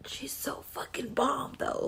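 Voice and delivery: hushed whisper, slow at the end. Real, not acted. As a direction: lean in and whisper the gush. Sudden hushed aside after calming.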